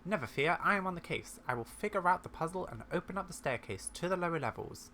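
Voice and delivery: grandly confident, voice lifted; heroic confidence. Acted. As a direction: announce it like a sleuth taking the case. Character heroically takes on puzzle.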